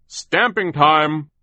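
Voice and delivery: high, loud, slow muffled bark; terrible muffled foreman. A narrator's reading. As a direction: call it out like a grotesque boss. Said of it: "Foreman Fakutono said".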